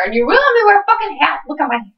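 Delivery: normal talking voice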